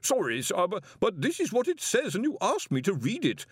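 put-on high, anxious young wizard pleading; nervous protest. A narrator's reading. Young wizard protests to the Arch-Chancellor, high and anxious. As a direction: protest timidly to the boss. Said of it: "Collarbone protested".

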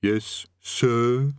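drawn-out, reluctant "yes, sir"; comply grudgingly with the correction; underling's voice, held on "sir"; distant underling. A narrator's reading. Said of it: "The distant voice muttered something".

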